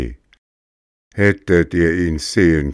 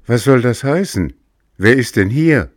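fearful; 6 semitones higher, 5 dB louder than usual. Acted. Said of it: "fragte furchtsam".

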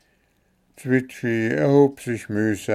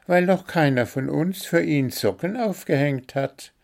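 higher bird voice, keen and sure; know-it-all little bird explaining; eager little bird; 7 semitones higher than usual. A narrator's reading.

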